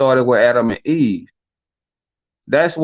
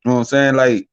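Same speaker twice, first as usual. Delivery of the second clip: normal talking voice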